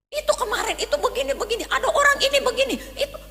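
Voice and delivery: flustered pitch jump while justifying himself; defensive outburst. Retold or imitated. Irritable worker blurting excuses. (pembelaan diri yang gugup dengan nada tinggi)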